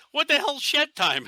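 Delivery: high, puzzled retold question; a new recruit confused by the patch, asking high and baffled; baffled new guy. New player asking the teller, high and baffled. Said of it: "and he's like".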